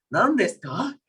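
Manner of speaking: high questioning tune on "Nan desu ka?". Shown as a demonstration. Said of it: "terakhirnya agak dinaikin".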